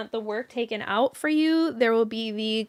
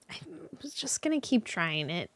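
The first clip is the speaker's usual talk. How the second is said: whispered aside; whispering; 10 dB quieter than usual. Real, not acted. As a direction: whisper it under your breath. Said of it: "whispered aside".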